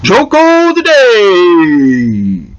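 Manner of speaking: drawn-out, slow show-title call; slow announcer title read; segment announcement. Acted. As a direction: announce the bit like a radio host.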